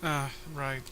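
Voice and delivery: ordinary talk, nothing marked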